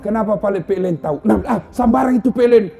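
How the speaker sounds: quarrel. Retold or imitated. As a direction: argue fast and hot. Couple quarrel. (suami istri bertengkar, suara tinggi dan cepat)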